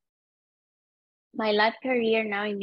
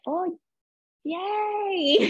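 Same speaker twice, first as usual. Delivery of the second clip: high, sarcastic little yay; fake-happy squeal of an awkward guest; sarcastic cheer, pitched much higher; mock cheer; 10 semitones higher than usual. Her mock-cheerful inner reaction.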